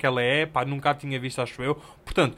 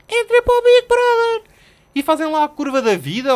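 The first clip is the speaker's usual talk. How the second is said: mock outraged shout; 13 semitones higher, 10 dB louder than usual. Real, not acted. Ranting at oversharing strangers.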